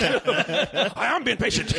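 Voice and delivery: slow laughing dog-like growl; growled Liam Neeson. Shown as a demonstration. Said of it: "just growl like a dog and talk".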